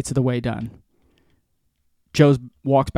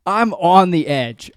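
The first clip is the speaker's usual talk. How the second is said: high, loud exasperated comic rant; retold end-of-his-rope outburst; losing it; 6 semitones higher, 4 dB louder than usual. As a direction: hammer each grievance, pitched up. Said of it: "I'm, like, losing my shit".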